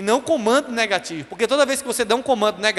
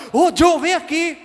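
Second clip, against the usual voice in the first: high-pitched, slowed, cross summons; angry mother; 7 semitones higher, 4 dB louder, slower than usual. Retold or imitated. Mother with raiva calling her son again, angry call. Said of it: "quando minha mãe tava com raiva".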